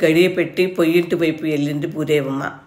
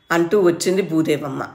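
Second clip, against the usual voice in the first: usual speaking voice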